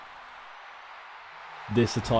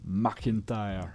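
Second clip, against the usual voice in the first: put-on Scottish accent; slower than usual. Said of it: "attempted a Scottish accent".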